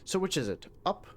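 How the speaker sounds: soft, lightly British NPC voice; a hint of a British tempter; British accent. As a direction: keep the gentle British accent going. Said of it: "kinda like a British accent".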